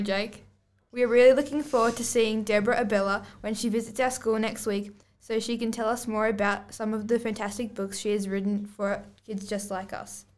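ordinary delivery

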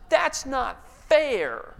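put-on drawn-out, high childish whine; whiny three-year-old. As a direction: whine it slowly like a toddler. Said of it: "what we all know ... a three-year-old".

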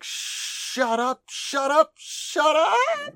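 escalating high-pitched character shriek; flustered anime girl yelling, hammed up; mock flustered screaming. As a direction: build from high protest to full squeal. Flustered Delta retold.

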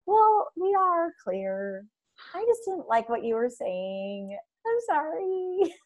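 slow, soft sheepish excuse; sheepish apology. Retold or imitated. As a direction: mumble an apology, caught out. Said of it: "you're like".

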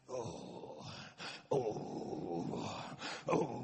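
low groaning oh; groaning in prayer. Shown as a demonstration. Pastor demonstrating groaning in the spirit, low. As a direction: groan from deep inside. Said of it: "quiet groaning".

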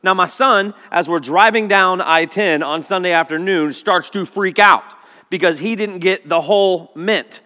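ordinary talk

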